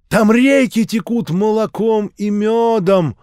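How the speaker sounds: high, loud, slow preaching voice; fervent street-preacher sermon; zealous old preacher. A narrator's reading. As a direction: warn him of doom like a revival preacher.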